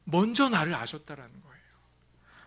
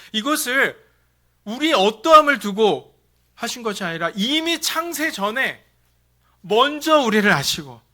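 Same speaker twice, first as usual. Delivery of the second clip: preaching at full force; 6 semitones higher, 10 dB louder than usual. Real, not acted. Sermon climax.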